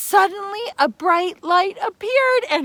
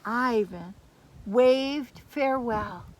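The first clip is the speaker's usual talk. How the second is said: soft, lower, tender narration; gentle farewell; 7 semitones lower, 8 dB quieter than usual. A narrator's reading. Storyteller softly narrating the Firebird's farewell. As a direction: ease into a calm ending.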